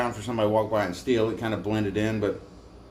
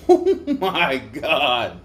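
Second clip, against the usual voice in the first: slow disgusted; 4 dB louder, much slower than usual. Retold or imitated. Imitating disgust at the smell.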